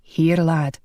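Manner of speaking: a hint of a kindly Scot over the lad; Scottish brogue. Angus voiced.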